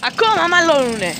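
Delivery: high and loud, falling away; loud high-pitched disbelief; exasperated exclamation. Real, not acted. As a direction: cry out that it's still going on. Exclaims dogs are still fighting.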